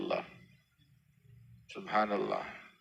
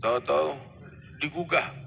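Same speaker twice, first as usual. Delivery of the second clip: normal talking voice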